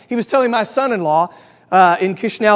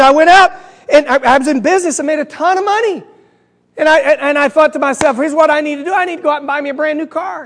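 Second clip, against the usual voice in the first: raised-pitch joke character; lightly acted, higher-pitched storytelling; mildly excited boasting; 5 semitones higher, 3 dB louder than usual. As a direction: tell it as a pleased braggart, lightly played. Voices German bragging in joke.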